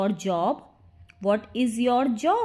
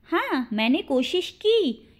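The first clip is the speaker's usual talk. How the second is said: usual speaking voice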